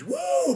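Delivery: a high, loud woo; high party whoop. Retold or imitated. Cheering at the bar over the Diamond Exchange idea, high loud whoop. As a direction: whoop like the bar just roared.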